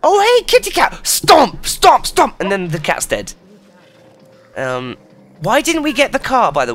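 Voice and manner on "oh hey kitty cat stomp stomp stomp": high, loud mock-friendly horse; hammed-up excitable animal voice; cartoon horse. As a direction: chirp a greeting, then punch each stomp. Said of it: "the horse came along and was like".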